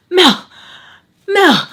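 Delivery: high, loud gasped-out calls; imitated yelling. Storyteller yelling at passed-out friend, high panicked mock yell. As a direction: re-enact calling a friend in a panic. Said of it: "I'm, like, yelling at her like this".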